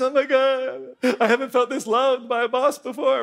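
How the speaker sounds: high mock-weepy gushing; imitated tearful gratitude. Tally crying next to Albie, imitated tearful gushing. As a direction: act overwhelmed and crying with thanks. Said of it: "Tally starts to cry next to him".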